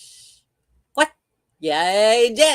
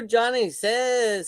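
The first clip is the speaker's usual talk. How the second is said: ordinary talk, nothing marked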